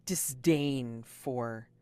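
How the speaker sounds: normal talking voice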